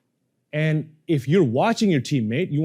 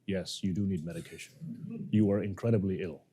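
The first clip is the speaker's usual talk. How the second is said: cold, mechanical and much softer; authoritative doctor; 6 semitones lower, 10 dB quieter than usual. Shown as a demonstration. Coach playing a doctor telling a patient he's ill, coldly. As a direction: deliver the diagnosis like an FBI director. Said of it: "So this is your authoritative".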